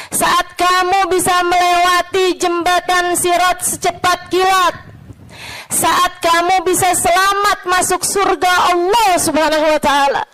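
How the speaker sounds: high, sustained sermon delivery; impassioned preaching. Real, not acted. Preacher picturing the hereafter to friends, impassioned. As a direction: build each phrase to a ringing end.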